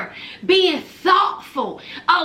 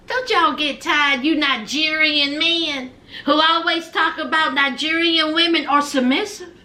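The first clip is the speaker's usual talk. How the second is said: normal talking voice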